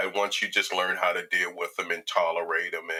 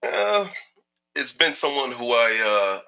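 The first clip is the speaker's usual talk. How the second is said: usual speaking voice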